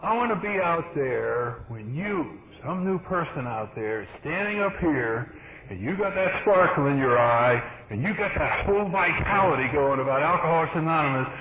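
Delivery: ordinary talk, nothing marked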